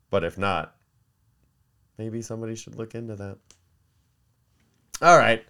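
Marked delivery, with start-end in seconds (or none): none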